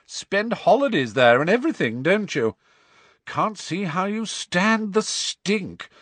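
high-pitched, contemptuous sneer. A narrator's reading. Malfoy mocks Harry for visiting the Weasleys, sneering. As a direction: draw out the disgust to provoke him.

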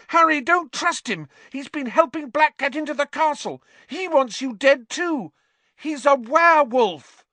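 high, loud frantic warning; frantic shouting. A narrator's reading. Hermione warns Harry against Lupin, high and loud. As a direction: warn Harry at full voice. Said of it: "Hermione screamed".

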